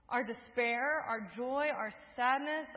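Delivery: ordinary talk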